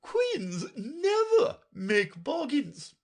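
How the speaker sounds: high, loud, slow and stiff; a queen drawing herself up in offence; stiff and haughty. A narrator's reading. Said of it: "drew herself up rather stiffly and said".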